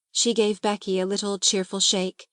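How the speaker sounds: plain reading voice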